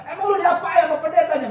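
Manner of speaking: loud, high, indignant demand; acted-out angry friend; angry questioning. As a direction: demand it like a mate ready to fight. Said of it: "Mereka sudah mau marah".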